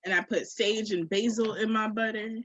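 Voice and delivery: usual speaking voice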